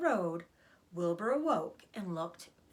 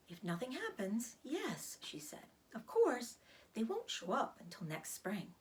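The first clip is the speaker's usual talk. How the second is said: Charlotte, weak spider; 3 semitones higher than usual. Acted.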